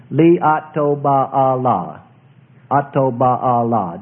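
"Li Atoba Allah" drawn out very slowly, syllable by syllable; "Li Atoba Allah" in slow, even, separate syllables; syllable demonstration. Shown as a demonstration. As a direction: spell out "Li Atoba Allah" by ear, stretching each vowel. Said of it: "That sounds very much variation there".

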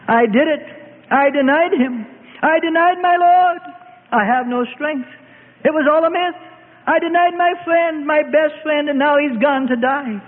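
high, anguished outcry; bitterly weeping. A narrator's reading. Peter begging forgiveness for his denial, anguished. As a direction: plead and lament high up, in despair. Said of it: "And Peter wept bitterly".

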